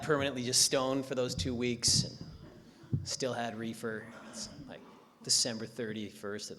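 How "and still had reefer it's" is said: ordinary talk, nothing marked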